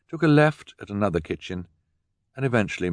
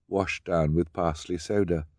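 usual speaking voice

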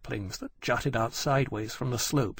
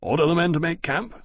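fast, higher-pitched order; said quickly; 6 semitones higher, 4 dB louder, faster than usual. A narrator's reading. Captain orders his officer to make camp, quick and raised. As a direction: rattle off the command briskly. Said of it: "Lawrence said quickly to Granby".

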